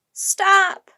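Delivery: high, loud shout; crying out. A narrator's reading. Annie tries to stop the armed sailors, crying out. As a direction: shout it out as an urgent command. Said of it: "cried Annie".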